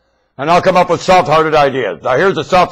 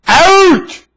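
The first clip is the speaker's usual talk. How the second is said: shouting out; 12 semitones higher than usual. Acted. Ordering workers out.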